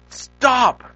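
high, sharp retold yell; mother yelling. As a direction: imitate a mother's yelled command. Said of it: "my mom yelled at me".

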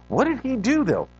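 ordinary talk

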